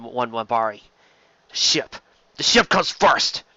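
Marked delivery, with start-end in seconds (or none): 1.5-3.4 urgent command. Acted. Connolly urges in battle.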